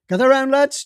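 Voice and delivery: a breezy senior detective waving him over; jovial boss calling out. Acted. Chisholm calls the team over.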